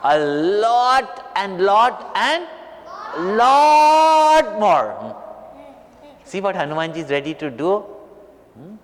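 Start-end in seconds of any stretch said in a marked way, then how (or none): 0.0-5.2 "lot" drawn out long, the last one high and loud; emphatic stretching. Real, not acted. Telling children how much Hanuman loves Ram, stretched "lot". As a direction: build to a huge, long "lot".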